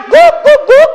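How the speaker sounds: loud, high triple woof; imitated dog barking. Dog shouts that the job is done, loud mock barking. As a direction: bark three times like a dog. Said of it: "Kalau dia udah teriak".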